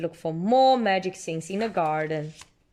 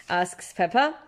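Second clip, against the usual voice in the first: usual speaking voice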